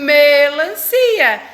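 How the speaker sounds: melancia drawn out very slow and loud, stressing the M. Shown as a demonstration. Teacher sounding out for the child. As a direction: stretch melancia out, lean on the M. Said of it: "porque, ó".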